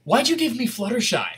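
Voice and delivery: mock-grave kid voice, held straight; serious little girl. Retelling the girl's reaction, imitated dead serious. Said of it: "she does just the seriousness".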